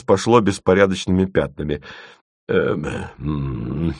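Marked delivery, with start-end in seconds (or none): 2.5-3.9 low, soft hoarse hemming. A narrator's reading. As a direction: hem and haw hoarsely, at a loss. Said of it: "сипло сказал доктор Корнеев". (сипло, растерянно)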